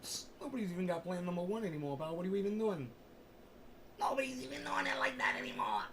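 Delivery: scoffing other voice. Pretend. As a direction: scoff at him from the side. Second voice mocking the first.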